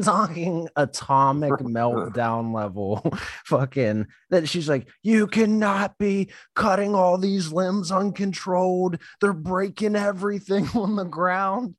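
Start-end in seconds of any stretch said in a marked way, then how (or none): 5.0-11.8 higher, mock-scolding lady voice; mocking the angry neighbor lady. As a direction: parody her bossy scolding, pitched up. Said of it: "this bitch came out and lost her fucking mind".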